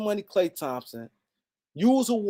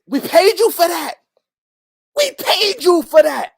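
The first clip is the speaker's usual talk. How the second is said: angry outburst; 8 semitones higher, 9 dB louder than usual. Real, not acted. Angry rant at Klay's contract.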